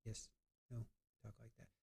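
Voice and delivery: mock mumble, barely audible; imitating a mumbling witness; mumbled imitation. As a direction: mumble it low like a shy witness. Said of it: "he would talk like this".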